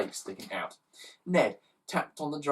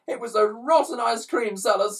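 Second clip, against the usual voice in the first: put-on high loud child's wail; hammed-up child sobbing; 10 semitones higher, 6 dB louder than usual. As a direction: sob it out as an upset schoolchild. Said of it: "sobbed one".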